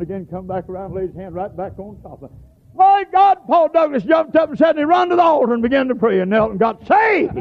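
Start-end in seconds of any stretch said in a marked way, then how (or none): 2.7-7.4 excited shout. Acted. Preacher telling of a sinner's conversion, high excited shout. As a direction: shout it out with rising fervor.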